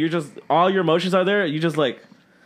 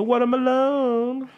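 quoting a meme; 8 semitones higher, much slower than usual. Retold or imitated. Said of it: "I always just remember the watermelon".

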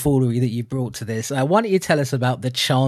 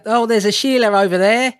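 loud, high hammed-up Aussie slang drifting toward Cockney; mock Australian accent gone Cockney; bad fake Australian accent; 8 semitones higher, 5 dB louder than usual. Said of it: "I've gone Cockney".

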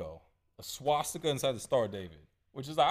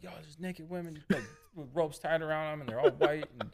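retold freak-out; 5 semitones higher than usual. Storyteller voicing Carolyn back from the basement, lightly freaked out. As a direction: imitate her urgent alarm, mildly. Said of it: "she freaked the fuck out".

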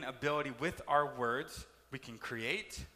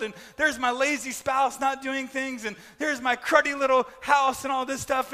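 higher, louder whiny litany of complaints; mock griping; 8 semitones higher, 9 dB louder than usual. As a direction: whine about everything, building steam. Mock complaining about car, family.

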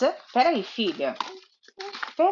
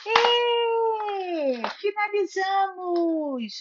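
higher, slow, sing-song cheer; cheerful finish; 7 semitones higher, much slower than usual. Real, not acted. Mother cheering her daughter at the story's end, sing-song. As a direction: celebrate finishing the book together.

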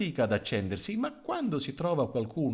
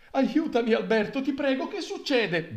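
frightened plea; 12 semitones higher, 3 dB louder than usual. Acted. Widow clinging to the mayor.

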